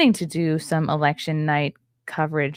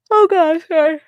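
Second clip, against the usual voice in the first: yawning through words; 11 semitones higher, 10 dB louder than usual. Real, not acted. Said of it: "is it normal to yawn this much?".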